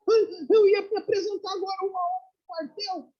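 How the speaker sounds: acted astonishment, voice shooting up; shocked realization. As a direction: blurt it out like a stunned young recruit. Recruit learns the war is over.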